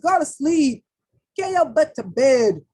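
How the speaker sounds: loud, bossy order to bed; firm command. Acted. Tarot reader ordering viewers to bed, loud and bossy. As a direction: bark it like a mom sending kids to bed.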